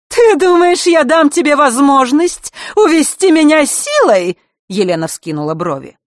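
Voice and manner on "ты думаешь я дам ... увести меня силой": very high, loud scornful challenge; indignant defiance. A narrator's reading. Elena refuses to be led away by force, indignantly. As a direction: fling the question back, high and loud. Villainess defies the queen's order.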